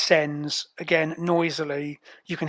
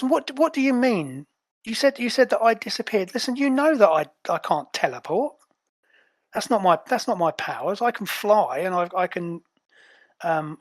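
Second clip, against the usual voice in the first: aggrieved and confused, pitched up; indignant Azure; 3 semitones higher than usual. Acted. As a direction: play a bewildered agent, voice raised in pitch.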